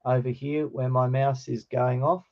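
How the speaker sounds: ordinary talk, nothing marked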